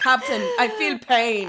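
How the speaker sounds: badly faked empath accent, laughing through it; mock-dramatic counselor feeling pain, half giggling; Deanna Troi impression. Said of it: "that is a terrible accent in every fucking way".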